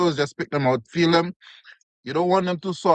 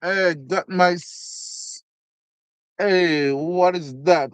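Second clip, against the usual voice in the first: usual speaking voice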